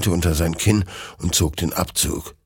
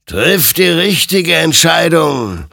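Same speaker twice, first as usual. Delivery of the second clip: slow, high, ringing exhortation; admonishing; 11 semitones higher, 7 dB louder than usual. A narrator's reading. Otherworldly rider warns Hector, loud drawn-out admonishment. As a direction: urge it loudly, stretching the words out. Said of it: "ermahnte ihn sein Gegenüber".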